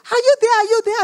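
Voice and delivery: high-pitched, urgent phone voice; acted frantic caller. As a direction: check anxiously that someone is on the line. Retold panicked phone call.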